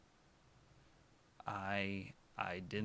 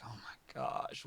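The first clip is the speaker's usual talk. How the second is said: mock crying whisper; 6 dB quieter, slower than usual. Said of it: "I was crying. I was like".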